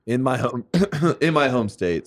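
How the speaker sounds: real vocal strain with a throat-clear; voice giving out. Real, not acted. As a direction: push through a voice that's going. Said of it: "I'm losing my voice because of this".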